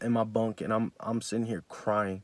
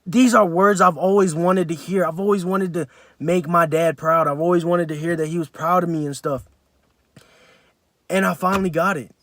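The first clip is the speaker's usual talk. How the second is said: overwhelmed joy; 6 semitones higher, 8 dB louder than usual. Real, not acted. Retelling answered prayer, overwhelmed.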